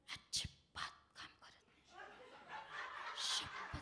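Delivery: hushed, breathy secret-telling; whispered gossip. Acted. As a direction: whisper it so she can't hear. Gossiping secretly about sister-in-law. (कुजबुजत सांगितलेली चुगली)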